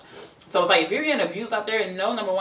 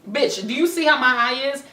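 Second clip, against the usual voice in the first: higher, sharp indignant comeback; indignant retort; 7 semitones higher, 3 dB louder than usual. Retold or imitated. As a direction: throw it back at him, outraged. Angry retort to abuser retold.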